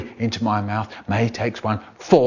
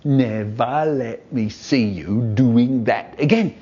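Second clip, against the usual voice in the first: slow, wagging-finger dad impression; mock stern dad; slower than usual. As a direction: drag it out like a telling-off father. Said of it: "we're both going".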